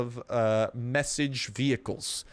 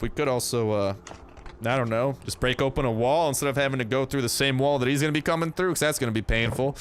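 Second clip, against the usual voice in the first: normal talking voice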